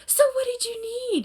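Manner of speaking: high-pitched, sweetly condescending question; put-on gracious voice, very high; mock benevolence. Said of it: "all benevolent and shit".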